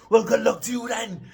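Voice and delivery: put-on very high Welsh-accented shout; proper excited. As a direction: shout good wishes in a thrilled Welsh accent. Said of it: "proper excited he was for us".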